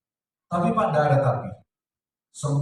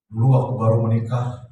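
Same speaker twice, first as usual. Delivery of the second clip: normal talking voice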